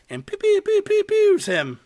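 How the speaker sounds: high pew pew sound effects; toy gun noises. Retold or imitated. Kid firing a toy gun at Death, high playful pews. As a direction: imitate a kid's cap gun firing. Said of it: "toy cap gun".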